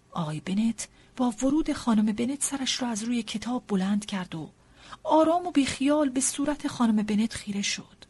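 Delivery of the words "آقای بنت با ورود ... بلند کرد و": ordinary delivery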